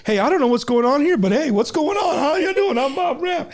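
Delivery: bright, high casual greeting; high, breezy imitation of a guy introducing himself; imitating a friendly stranger. Imitating Bob Ram's greeting.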